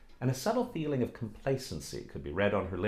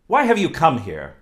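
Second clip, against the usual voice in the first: loud, sharp scolding, much louder; 6 semitones higher, 10 dB louder than usual. Acted. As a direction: drive the suitor off with a tongue-lashing. Oksana scolds the blacksmith.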